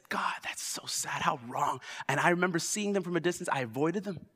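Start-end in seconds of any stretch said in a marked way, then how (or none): 0.1-1.8 hushed aside. Retold or imitated. Retold prayer, whispered.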